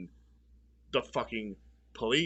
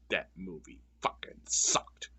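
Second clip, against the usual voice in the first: hateful whisper; 7 semitones lower than usual. Real, not acted. Closing rant whispered.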